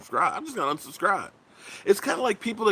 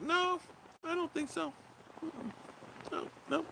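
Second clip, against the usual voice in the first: mock denial; 13 semitones higher, 13 dB quieter than usual. Dismissing threat of lost subs.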